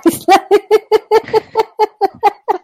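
high laughing fit; helpless giggle; real laughter. Real, not acted. Host cracking up at the name joke, real laugh.

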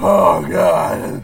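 loud cry as the heat builds; pained shout. Real, not acted. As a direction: shout out from the burn. Burn searing in more.

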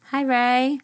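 ordinary talk

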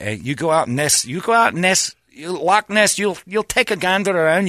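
lightly hammed Scottish accent; Scottish friend impression. Imitating a Scottish friend on Loch Ness, mock Scottish accent.

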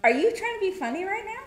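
are you kidding me, fast; needling your partner in mock disbelief; incredulous tease. Real, not acted. Teasing disbelief at partner's claim.

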